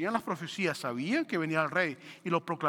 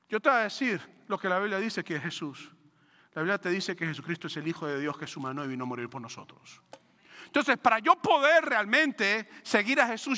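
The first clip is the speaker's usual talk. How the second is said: usual speaking voice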